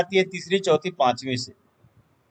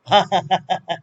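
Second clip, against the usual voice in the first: short run of chuckles; laugh. Real, not acted. Teacher laughing after asking if students enjoyed it, amused. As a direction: laugh in a quick string of ha-has.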